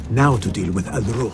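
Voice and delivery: ordinary talk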